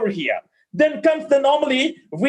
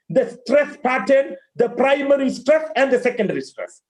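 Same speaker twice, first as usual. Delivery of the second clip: ordinary delivery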